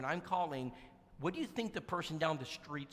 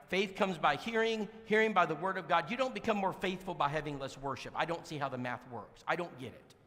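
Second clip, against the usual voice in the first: ordinary delivery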